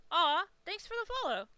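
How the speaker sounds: a sudden bright aside to a new follower; delighted thanks. Real, not acted.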